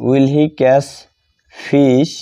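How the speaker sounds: normal talking voice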